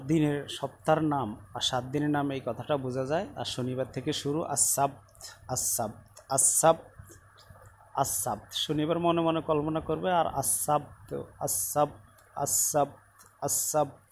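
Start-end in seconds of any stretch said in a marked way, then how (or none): 4.4-8.5 আসাবত said with a stopped final t; pausal Arabic form. Shown as a demonstration. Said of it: "এভাবে ওয়াকফ করে বললে এই হবে". 10.1-14.1 আসাবত repeated with a stopped final t, slow on আর; pausal Arabic form. Shown as a demonstration. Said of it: "এভাবে ওয়াকফ করে বললে এই হবে".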